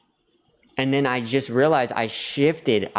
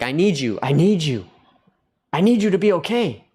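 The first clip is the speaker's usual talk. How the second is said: insistent plea, growing more emphatic; mock neediness; 4 semitones higher, 4 dB louder than usual. Showing how he didn't approach people outside, mock-desperate. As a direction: act out clinging to people. Acts out needy approach to strangers.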